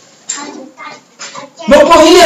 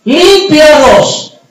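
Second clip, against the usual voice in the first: crying out; 8 semitones higher than usual. Acted. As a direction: call out for mercy across a distance. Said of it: "ellos empiezan a gritar".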